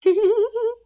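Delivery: high, loud triumphant laugh; gloating laugh. Acted. Sister-in-law alone after dodging the chores, gloating laugh. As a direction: laugh like a schemer who got away with it.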